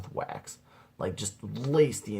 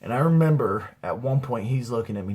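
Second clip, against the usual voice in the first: usual speaking voice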